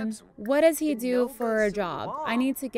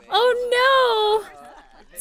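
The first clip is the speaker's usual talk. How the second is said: laughing dismay; 12 semitones higher, 8 dB louder than usual. Real, not acted. Reacting to rejection.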